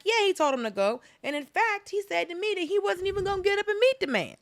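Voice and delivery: high-pitched imitated testimony; mock tattling aide. Acting out the aide's tattling quote.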